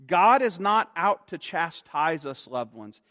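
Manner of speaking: ordinary delivery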